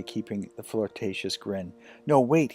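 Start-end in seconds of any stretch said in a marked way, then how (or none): 2.1-2.5 louder, higher, hurried plea; frantic. Acted. Jack frantically stopping Addison from backing away, higher and louder. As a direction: blurt it out in a rush. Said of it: "frantically thinking of another line".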